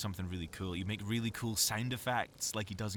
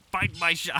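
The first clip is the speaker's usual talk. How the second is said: loud, higher mock robot bark, cut off; John DiMaggio as a robot; 9 semitones higher, 11 dB louder than usual. Voice actor mimics a cartoon robot for his friend, loud. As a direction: blurt a hammed-up cartoon robot line and break off. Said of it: "not even a good impression of him".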